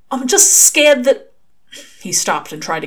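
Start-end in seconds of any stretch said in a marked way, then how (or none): 0.1-1.3 scared halting. Acted. Crowley halting confession.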